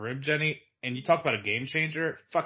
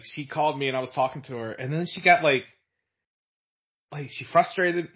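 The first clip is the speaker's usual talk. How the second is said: normal talking voice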